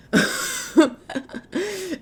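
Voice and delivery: high, light giggle at her own joke; short high-pitched laugh. Real, not acted. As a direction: break into a little giggle.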